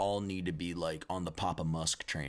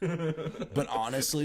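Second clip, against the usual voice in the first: laughing; 10 semitones higher, 4 dB louder, much slower than usual. Real, not acted. Slow high laughing aside.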